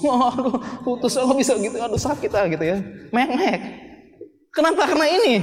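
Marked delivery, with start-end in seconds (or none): 0.1-2.5 higher, whiny lament of a dumped tough guy; mock heartbroken friend. Preacher mimics heartbroken friend, higher whiny moan. 3.1-5.3 much higher, whiny sobbing mimic; mock whimpering. Preacher mocks friend crying after breakup, much higher whine.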